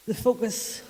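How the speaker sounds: ordinary talk, nothing marked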